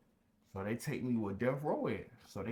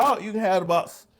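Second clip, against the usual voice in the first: loud, higher, quick giving up; hasty loud surrender; 7 semitones higher, 13 dB louder than usual. Retold or imitated. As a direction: give in fast and loud. Hurried surrender.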